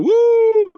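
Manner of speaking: loud, very high "woo"; commercial catchphrase whoop. Acted. Ex-player recreating his Geico commercial for the interviewer, high whoop. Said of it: "for my Geico commercial".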